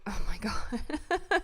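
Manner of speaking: slow, breathless whispered disbelief; awed hushed gasp of words; stunned whisper. Real, not acted. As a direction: whisper it in shock. Reacting to a three-pointer.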